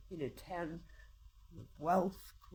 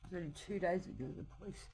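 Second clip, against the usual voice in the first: ordinary talk, nothing marked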